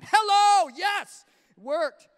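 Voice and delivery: very loud, high, drawn-out cheer; triumphant delight. Acted. Husband celebrating that praise worked, triumphant. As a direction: holler it out, stretched in triumph.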